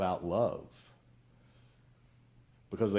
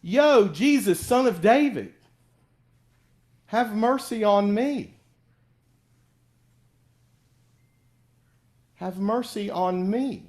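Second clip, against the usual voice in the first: loud, high, drawn-out plea; calling out; 9 semitones higher, 7 dB louder, slower than usual. Acted. Blind Bartimaeus calling to Jesus over the crowd, loud pleading. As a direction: call out across a crowd for mercy. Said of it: "Bartimaeus calls out".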